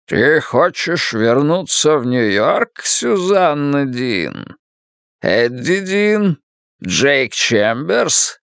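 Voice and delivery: slow, grand wizard voice; booming Oz voice. Acted. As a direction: ask slowly like a mighty wizard. Oz voice asks.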